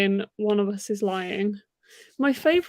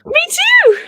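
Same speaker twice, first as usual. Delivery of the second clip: very high, loud burst of excitement; shrieky, overjoyed outburst; overexcited high-pitched squeal; 11 dB louder than usual. Real, not acted. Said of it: "It went so high-pitched that only dogs could hear me".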